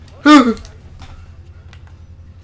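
loud high laugh; relieved laughter. Real, not acted. After jumpscare.

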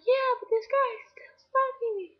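high, loud whine, dropping in volume at the end; distressed retold complaint. Girl complaining to friend about her stalker, high-pitched distress. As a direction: wail it like an acted complaint.

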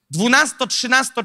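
normal talking voice

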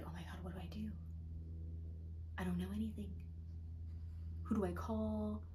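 retold panic in a near-whisper; hushed freaking out. Alone with the news, retold in a panicked whisper. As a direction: whisper it, at a loss. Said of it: "I'm, like, freaking out".

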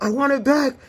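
high mock demand; whining villain. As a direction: whine for it back. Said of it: "And look, this evil".

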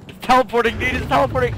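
alarmed shouting. Real, not acted. Monster teleporting, alarm.

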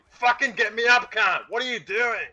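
high-pitched, indignant shouting; exasperated yell. Real, not acted. Downed gamer at the player who ran past, exasperated yell. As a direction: berate a useless teammate at high pitch.

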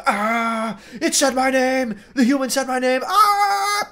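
high, far-off hammy demon screech; mock demon scream. Demon hammily screaming when the human says its name. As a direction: squeal like a cartoon demon hearing its name. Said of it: "like, screaming".

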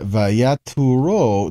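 Vayaturo drawn out slowly, syllable by syllable; slow Hebrew word. Shown as a demonstration. Teacher spelling out Vayaturo slowly for students, drawn out. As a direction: stretch out Vayaturo for the class. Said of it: "So, va".

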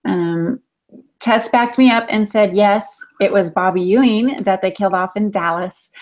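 ordinary talk

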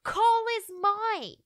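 high, loud jealous yell; screaming. Acted. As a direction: shriek the accusation slowly. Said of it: "charged towards me screaming".

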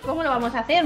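normal talking voice

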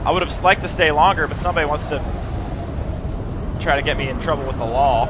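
high, loud grumble about someone; loud, high-pitched protest; loud complaint. Real, not acted. Still loud after fleeing the music.

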